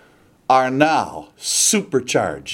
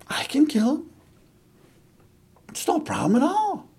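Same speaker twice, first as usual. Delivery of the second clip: put-on high, softer boyish assurance; eager young David; 11 semitones higher, 5 dB quieter, faster than usual. Little David brought before Saul, offering confidently. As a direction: pitch it high and light, no big deal.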